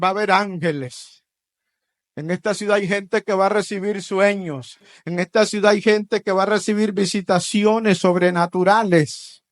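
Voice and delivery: loud, rising preaching; preaching at full force. Acted. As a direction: proclaim it to the congregation. (predicación a todo pulmón)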